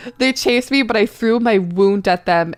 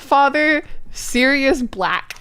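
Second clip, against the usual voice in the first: normal talking voice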